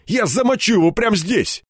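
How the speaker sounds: high, strained furious threat; hoarse threat. A narrator's reading. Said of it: "прохрипел он". (хрипло и злобно угрожая)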